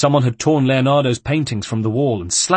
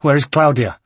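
raised, frantic question; 5 semitones higher, 7 dB louder than usual. Acted. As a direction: demand to know, alarmed. Ezio frantic for family.

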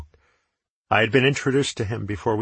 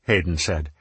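ordinary delivery, nothing marked

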